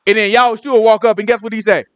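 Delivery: normal talking voice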